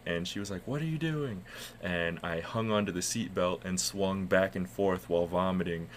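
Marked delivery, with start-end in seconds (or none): 0.7-1.4 high-pitched, incredulous mock question; put-on higher voice of a bewildered wife; baffled wife. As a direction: imitate your wife's appalled disbelief. Wife baffled at him, retold.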